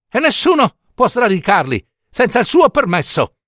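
put-on high-pitched, insistent little old woman; urgent old woman. Old woman tells the mother how to stop Death, urgently.

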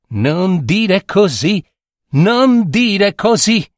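slow, high little voice. A narrator's reading. Little voice warning the girl, slow and high. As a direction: draw the warning out high and small. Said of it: "A quella vocina".

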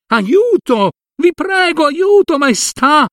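cat begging the king to save his master; cry for help. Acted. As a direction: shout for help to the king, frantic. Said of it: "a sbracciarsi e a chiedere".

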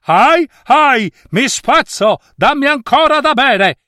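very high, desperate pleading cry; shrieking rope. A narrator's reading. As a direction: wail at the top of the voice as a snapping rope. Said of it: "la corda gridò ancora".

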